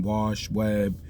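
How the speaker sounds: usual speaking voice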